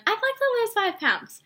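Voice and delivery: dismissive imitation of a shallow goal; sellers' prospect quoted in a high voice; mock client. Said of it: "so much more powerful than".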